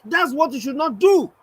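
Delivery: very high-pitched scolding; imitated anger. Angry critic telling the poet off, mock outrage. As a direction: mimic an indignant telling-off. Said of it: "They were very pissed".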